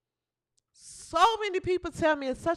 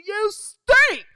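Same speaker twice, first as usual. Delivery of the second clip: shouted taunt, pitched up; mock heckler yell; 5 semitones higher, 7 dB louder than usual. Heckler taunting the player, mocking yell. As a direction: bark it like a heckler in the stands. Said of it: "people are probably yelling to you".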